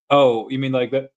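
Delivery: ordinary talk, nothing marked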